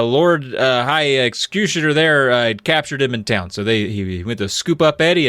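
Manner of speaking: normal talking voice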